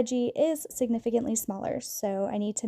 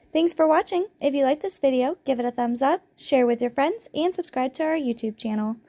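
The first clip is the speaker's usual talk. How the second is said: louder, brighter sign-off call to action; upbeat outro; 3 semitones higher, 4 dB louder than usual. Real, not acted. Host signing off to viewers with a louder upbeat pitch. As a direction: pitch the channel with energy.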